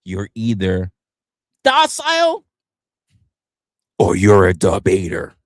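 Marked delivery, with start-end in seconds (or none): 1.6-2.4 high, louder, sing-song docile; mock docile. Streamer mocking the choice, high docile.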